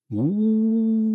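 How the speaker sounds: long high mournful howl; dog howl. Acted. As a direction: howl at the ground. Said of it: "и завыл".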